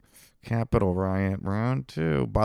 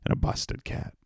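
mock whisper; 9 dB quieter than usual. Taunt trails into whisper.

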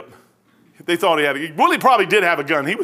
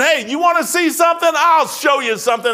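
Holy Spirit's call; 7 semitones higher than usual. Retold or imitated.

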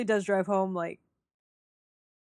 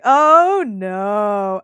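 dismayed; 8 dB louder than usual. Retold or imitated. Dreading what the scene becomes.

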